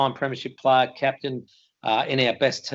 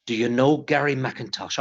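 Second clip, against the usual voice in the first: put-on Irish accent. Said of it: "Pardon the accent".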